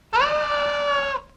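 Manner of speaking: very high, sustained scream; rehearsed scream. Pretend. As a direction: let out a long, piercing stage scream. Rehearsed scream after the cue.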